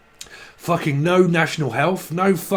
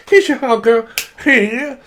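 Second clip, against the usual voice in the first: mock cheery greeting; 8 semitones higher, 6 dB louder than usual.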